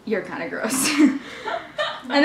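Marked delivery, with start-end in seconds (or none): 0.1-0.9 low, soft deadpan comeback; deadpan retort. Retold or imitated. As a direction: answer back quietly, dropped low. Deadpan retort to date's remark.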